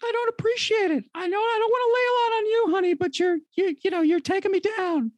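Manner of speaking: high, fast, put-upon mom voice; guilt-tripping mom. Acted.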